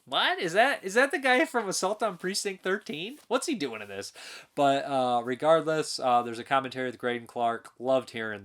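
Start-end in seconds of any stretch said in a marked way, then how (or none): 0.1-4.1 much higher, mock astonished recognition; surprised. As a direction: act out a startled double take. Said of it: "I was like".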